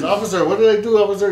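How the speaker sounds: imitating a Cuban-accented driver, high and loud. Man questioning an officer in a TikTok, imitated Cuban accent. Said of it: "and he has an accent".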